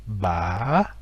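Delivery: ba qui monte, descend puis remonte; ba en trois temps, montée descente remontée; question tone. Shown as a demonstration. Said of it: "avec l'accent interrogatif". (ba avec accent interrogatif)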